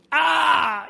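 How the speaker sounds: high, loud possessed cry; sudden high shriek of a possessed man; demon scream. Acted. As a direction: let the demon's cry burst out. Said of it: "Y ahí póngale color".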